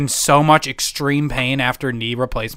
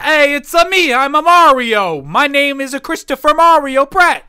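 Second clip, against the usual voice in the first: Mario voice; 12 semitones higher, 6 dB louder than usual. Pretend. Mario impression, Chris Pratt joke.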